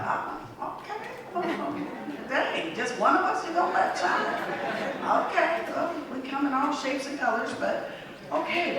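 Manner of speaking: high sassy hurt reaction; sassy disbelief. Retold or imitated. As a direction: act stung but sassy, brushing it off. Retold sassy reaction to rejection.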